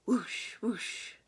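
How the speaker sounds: airy whooshing sound effect; whooshing ball sound. Acted. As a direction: breathe out the rushing pitch. Pitch whooshing past.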